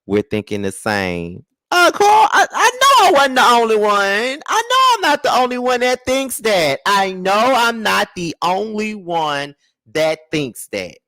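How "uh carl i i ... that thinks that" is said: high-pitched, emphatic outburst; excited insistence. Real, not acted. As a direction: insist at a much higher pitch. Excitedly insists to live viewers.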